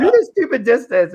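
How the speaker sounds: loud, high retelling through laughter; laughing self-quote. Retold or imitated. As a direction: laugh while mimicking your own clueless pitch. Laughing while repeating own quote.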